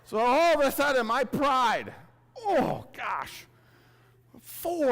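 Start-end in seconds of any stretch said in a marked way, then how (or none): none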